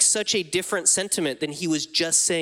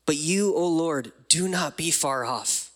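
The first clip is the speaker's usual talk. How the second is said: ordinary delivery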